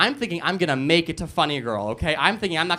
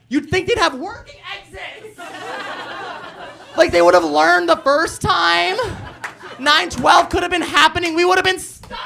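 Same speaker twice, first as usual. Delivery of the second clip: building excitement; 12 semitones higher, 5 dB louder than usual. Real, not acted. Punchline climax.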